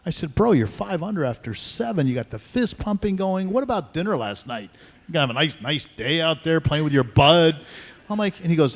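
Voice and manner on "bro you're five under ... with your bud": higher-pitched, protesting to a buddy; retold aggrieved ribbing; mock indignant complaint. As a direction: rib a friend as if hard done by. Retold complaint to rival friend.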